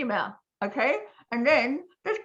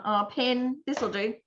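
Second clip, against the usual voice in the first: ordinary talk, nothing marked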